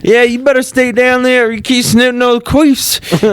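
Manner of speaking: very high put-on yokel voice; mock feuding local. Rival locals warning the sniffers, mock feuding voice. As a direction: taunt them in a hammed-up high-pitched voice. Acted feud with the outcasts.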